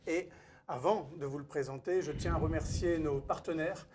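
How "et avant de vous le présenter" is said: ordinary talk, nothing marked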